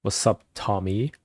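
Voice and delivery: usual speaking voice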